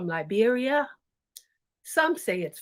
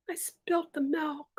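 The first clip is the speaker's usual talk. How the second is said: hushed confession; ashamed whisper; 3 semitones higher, 7 dB quieter than usual. Acted. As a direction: whisper it in shame.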